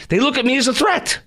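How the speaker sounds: high-pitched, offended disbelief; pissed off. Real, not acted. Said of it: "I'm pissed".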